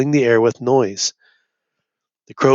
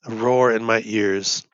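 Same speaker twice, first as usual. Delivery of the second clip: ordinary delivery, nothing marked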